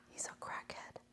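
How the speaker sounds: hushed confiding; whispered secret. Real, not acted. Telling viewers the streamer is a crackhead, whispered. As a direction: whisper it behind your hand.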